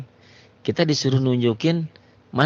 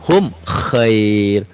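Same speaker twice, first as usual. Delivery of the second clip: Quran recitation; 4 dB louder than usual. Shown as a demonstration. Recites example.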